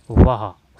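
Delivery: loud joyful wah of praise; delighted wah. Shown as a demonstration. Teacher shouting wah to show joy. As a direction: exclaim it with real delight. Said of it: "ये हर्ष सूचक है".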